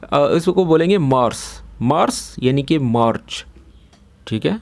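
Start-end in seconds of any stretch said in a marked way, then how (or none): 1.0-3.4 मार्स with an s, not a ch sound; Arabic pronunciation. Shown as a demonstration. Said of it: "चे नहीं बोलेंगे". (मार्स में चे की जगह स)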